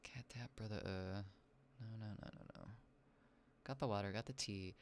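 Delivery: soft whispered mutter. Real, not acted. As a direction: keep it hushed. Quiet whispering.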